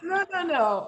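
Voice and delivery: high, playful no-no-no; teasing refusal. Retold or imitated. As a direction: tease him by refusing. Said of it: "I said".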